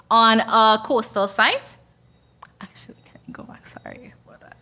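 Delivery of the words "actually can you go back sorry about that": quick whispered aside; whispering. Real, not acted. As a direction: whisper it fast, apologetic. Asks operator to go back.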